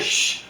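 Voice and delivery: soft "shh"; quick hushing hiss; imitated shushing. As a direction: shush someone, imitating the man. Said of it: "he tells her to shh".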